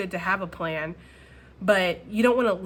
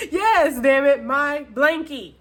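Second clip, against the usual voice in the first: louder, slow, hammed-up insistence; mock insistence; 5 semitones higher, 7 dB louder, slower than usual. Vlogger jokingly insists on her blanket, slow and hammed-up. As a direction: stretch out my blankie in playful mock defiance.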